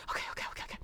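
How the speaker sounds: whispered under breath; steady yourself in a whisper; calming herself in the elevator; nervous whisper. Retold or imitated. Nervous self-talk in elevator.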